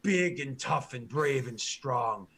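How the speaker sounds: slow broken-grammar orc boasting of his hero; proud orc guard. Acted. Orc guard proudly tells the party who Grommen is, slow boasting. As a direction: puff up and drag out each boast like a devoted grunt.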